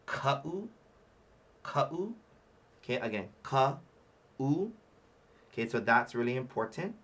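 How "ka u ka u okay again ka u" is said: "ka-u" said slowly with a throat catch between vowels; slow "ka-u", stop the air before the "u"; glottal stop demo. Shown as a demonstration. Teacher drills the word "ka-u" for the class. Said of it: "The word is ka-u".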